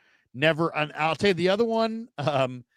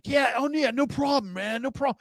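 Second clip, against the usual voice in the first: put-on much higher, boyish surfer-dude voice; immature young quarterback; 11 semitones higher than usual. Rookie QB brushing off the radio reporter, high slacker voice. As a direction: play a kid who rode in on a skateboard. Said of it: "he sounded so immature".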